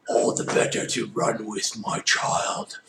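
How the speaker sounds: put-on husky wolf-granny voice; wolf posing as grandmother. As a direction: rasp it like a disguised wolf.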